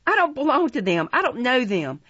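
high, loud protest; struggling cry. A narrator's reading. Oliver struggling in the man's grasp, urgent cry. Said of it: "cried Oliver, struggling".

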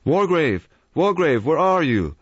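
high, loud call across the house; calling out loudly. A narrator's reading. The doctor calls through the house for the judge, loudly. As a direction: shout the name out. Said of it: "called out loudly".

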